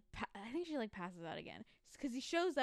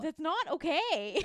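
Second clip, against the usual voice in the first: firm objection; 6 semitones higher, 7 dB louder, slower than usual. Retold or imitated. He objects firmly.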